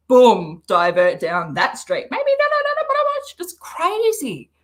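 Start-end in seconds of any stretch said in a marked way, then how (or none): 2.1-4.5 mock frantic. Imagined frantic inner voice.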